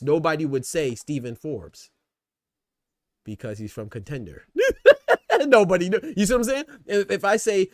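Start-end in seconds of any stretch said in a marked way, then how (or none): 4.5-5.4 loud high laugh; high-pitched cackling laugh. Real, not acted. Laughs at own joke. 5.5-7.4 words breaking up with real laughter; loud, laughing attempt to speak. Real, not acted. Laughing mid-sentence.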